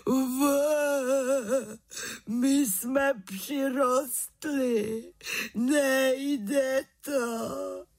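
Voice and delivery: high-pitched, drawn-out mock bawling; crying. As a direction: bawl each word like a helpless boy. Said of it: "A Béďa Krejčích brečel". (plačtivě, vysoko a táhle)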